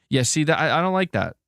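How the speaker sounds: a real I-refuse-this whine, pitched high; exasperated protest. Real, not acted. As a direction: push back, fed up with the pronunciation. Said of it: "I'm so tired of all this nonsense".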